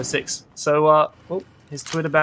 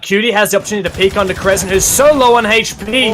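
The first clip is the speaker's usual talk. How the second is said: esports caster; 4 semitones higher, 5 dB louder than usual. Real, not acted. Live play-by-play.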